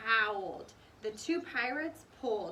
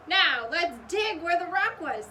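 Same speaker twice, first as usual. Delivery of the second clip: eager; 7 semitones higher, 10 dB louder than usual. A narrator's reading. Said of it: "said Jack".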